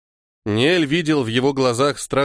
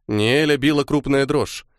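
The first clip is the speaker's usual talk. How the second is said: usual speaking voice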